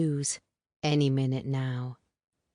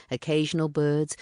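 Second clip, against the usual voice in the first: plain reading voice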